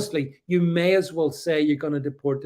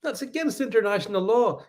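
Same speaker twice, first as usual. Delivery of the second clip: mock objection; 7 semitones higher than usual. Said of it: "And if people say".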